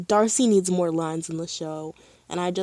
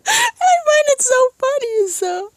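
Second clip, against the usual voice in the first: high, loud, helpless laughter through the words; real laughing fit; 9 dB louder than usual. Real, not acted. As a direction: crack up while talking. Cracks up at the scene.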